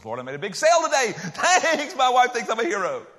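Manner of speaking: high, loud gush of thanks; hammed-up excitement with a laugh in it; mock elated prayer. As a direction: gleefully brag to God about a win. Models reviewing the day's highs.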